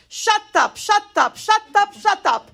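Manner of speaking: put-on high, insistent Israeli-accented outburst. Israeli friend cutting off her grieving friend's rant, Israeli accent. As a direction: fire it off four times in an Israeli accent, high and sharp. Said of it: "with her Israeli accent, says".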